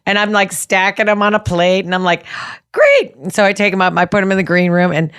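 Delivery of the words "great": very high, chirpy one-word cheer; delighted. Acted. Retelling her glee while stacking rescued donuts, delighted. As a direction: squeak it with mock glee.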